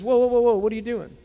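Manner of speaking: a disciple cutting in, startled; startled interruption. Acted. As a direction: stop Jesus mid-act in alarm. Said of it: "Peter interrupts".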